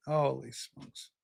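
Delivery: hushed stunned holy smokes; quiet awe. Real, not acted. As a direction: breathe it out low and slow in disbelief. Host reacting to chat.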